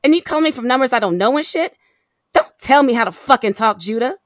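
loud, indignant telling-off; furious, punched swearing; angry tirade. Acted. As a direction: lash out at an ex who demands respect. Furious phone quarrel with abusive ex.

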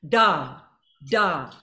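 short bouncing da; accented da. Shown as a demonstration. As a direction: punch each da from the gut. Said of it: "bouncing off the wall on the accents".

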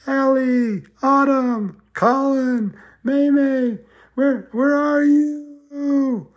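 high, slow, drawn-out calling; faraway searching call to lost kids; yelling names. Acted. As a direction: call across a field searching. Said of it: "yelling our names".

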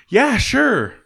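high-pitched, put-on agreeable reply; mock-eager yes; retold breezy agreement. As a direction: agree too readily, acting it out. Acted reply before a jab.

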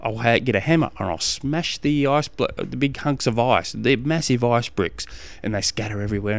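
normal talking voice

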